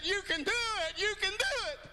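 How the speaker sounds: high, earnest you-can-do-it; encouraging teacher. Retold or imitated. Teacher urging a failing boy, warm and high. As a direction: cheer a struggling student on. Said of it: "She said".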